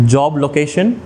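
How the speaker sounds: ordinary talk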